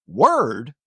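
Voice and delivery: clueless copy of Word; confused echo. Retold or imitated. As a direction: echo it back not knowing what it means. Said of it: "And I'm like".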